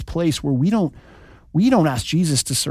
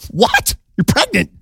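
a sudden high squeal of disbelief; high-pitched shocked Joseph, hammed up; shocked squeak; 9 semitones higher than usual. Joseph hears Mary is pregnant, squeaking in shock.

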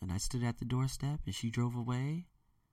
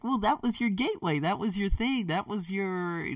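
high-pitched mock protest; imitated viewers; 9 semitones higher than usual. Viewers urging him about the family group, mock insistence. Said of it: "a lot of you are like".

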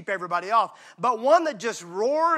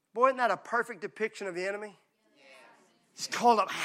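normal talking voice

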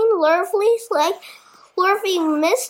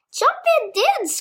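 higher rooster call; rooster crying; 7 semitones higher than usual. A narrator's reading. Rooster calling to Wilbur, lightly voiced. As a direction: crow it out. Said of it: "cried the rooster".

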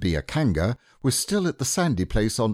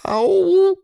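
very high, loud squealing ow; cry of pain; 10 dB louder than usual. Acted. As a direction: squeal as the flannel scrubs. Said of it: "cried Piglet".

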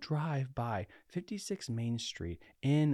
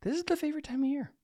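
excited; faster than usual. Real, not acted. Gushing about Halloween season.

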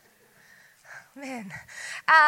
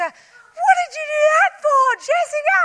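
mimicking mum; 12 semitones higher, 5 dB louder than usual. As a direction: squeal it like a shrill parody of mum. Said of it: "You don't sound like that".

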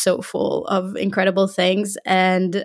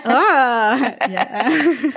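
laughing; 4 semitones higher, 3 dB louder than usual. Real, not acted. Laughing at "sexy" remark.

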